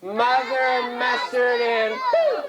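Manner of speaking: high, drawn-out magic chant; high-pitched call with a big poof. Shown as a demonstration. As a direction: lead the kids in a bigger incantation. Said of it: "let's be a little louder".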